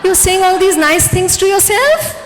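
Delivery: high, laughing incredulous hmm; mock surprised brain, laughing; surprised laughing disbelief. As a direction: play the brain caught off guard. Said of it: "Your brain will say".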